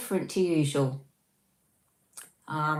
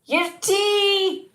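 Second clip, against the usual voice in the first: high, loud exaggerated Scottish accent; hammed-up Scottish shout; 8 dB louder than usual. Shown as a demonstration. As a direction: belt it out in a mock Scottish accent. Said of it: "You say it in a Scottish accent".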